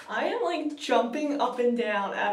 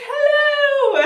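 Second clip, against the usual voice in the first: very high, loud, sung-out greeting; imitated Doctor's greeting; 11 dB louder than usual. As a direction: call it out high and showy.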